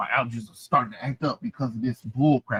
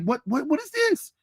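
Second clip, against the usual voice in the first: what is this, squeaked very high in disbelief; incredulous. Real, not acted. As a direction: jump way up in pitch, baffled. Reacting to crying panel.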